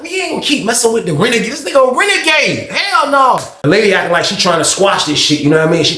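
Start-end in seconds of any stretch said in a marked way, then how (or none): 0.0-3.4 high exclaiming voice; excited exclaim. Retold or imitated. Inmates agreeing to avoid him, high voice. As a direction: swear off the renegade.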